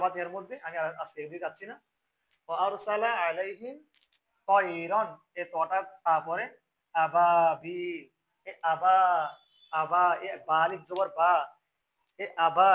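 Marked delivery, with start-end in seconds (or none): 2.5-12.7 louder, slow recitation stretching আবাবিল; Quran recitation. Shown as a demonstration. Teacher stretching the long vowel for students, louder. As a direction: draw out the আ of আবাবিল long and loud. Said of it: "এটা আনতে হবে".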